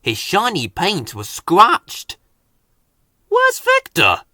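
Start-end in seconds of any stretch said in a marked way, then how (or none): 3.3-4.2 high, haughty engine voice; lofty engine character; posh engine voice. A narrator's reading. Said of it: "Spencer asked".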